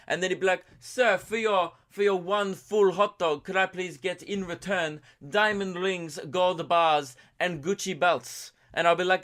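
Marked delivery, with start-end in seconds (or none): 0.8-8.5 slow, higher-pitched Italian vendor; name the price like a hammed-up Italian; mock Italian accent. Italian vendor asking payment for a hot dog, mock Italian accent. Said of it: "he'd probably be Italian".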